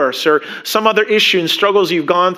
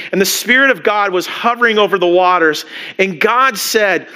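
ordinary talk, nothing marked

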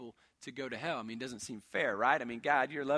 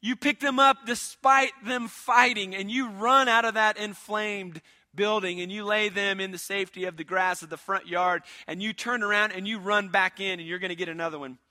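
raised, forceful sermon storytelling; louder, higher preacher in full flow; impassioned preaching; 5 semitones higher, 8 dB louder than usual. Acted. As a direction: build the rescue with mounting urgency. Preacher drives rescue illustration.

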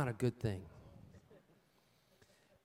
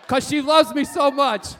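much louder and higher, laughing along; playful shouting; 7 semitones higher, 11 dB louder than usual. Real, not acted. Pastor teasing the congregation about married love. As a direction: tease the crowd with a big grin.

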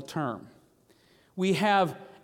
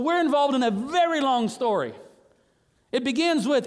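ordinary talk, nothing marked

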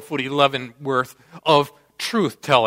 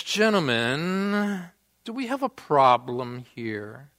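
slow, softer, self-assured challenge; confident police officer; 4 semitones lower, 5 dB quieter than usual. Retold or imitated. Small officer confronting two drunk guys, quietly confident. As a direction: mildly imitate an unruffled officer stepping in. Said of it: "this very confident four-foot-eleven officer".

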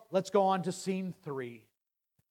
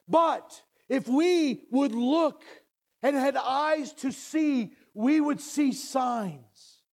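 raised, ringing sermon voice; fervent preaching; 6 semitones higher than usual. Acted. Preacher urging the congregation, fervent preaching. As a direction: proclaim it to the pews.

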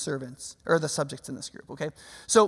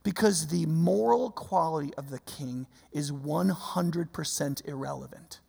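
ordinary talk

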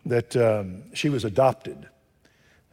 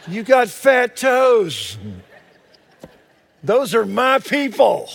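high, loud and slow; joyful preacher shout; excited shout; 9 semitones higher, 7 dB louder, slower than usual. Real, not acted. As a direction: belt it out to the room. Preacher exclaims to congregation.

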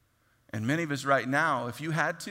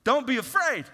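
preached command; 10 semitones higher, 6 dB louder than usual. Retold or imitated. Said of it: "Moses told the people".

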